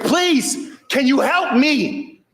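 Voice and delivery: very high, stretched-out begging; imitated scream for help. Lady in the grass begging neighbor, mock high scream. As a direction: plead in a slow mock wail. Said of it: "screaming for help".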